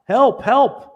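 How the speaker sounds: high, loud cries for help; frantic calls for help; screaming for help. Retold or imitated. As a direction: yell up desperately from the bottom of a pit. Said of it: "he's screaming for help".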